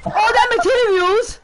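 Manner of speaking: normal talking voice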